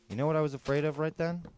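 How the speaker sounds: usual speaking voice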